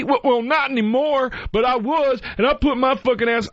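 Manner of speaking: high, defensive mock voice; a hint of an indignant veteran; imitating the lady. Mimicking the Navy woman defending herself. Quoting the lady's reply.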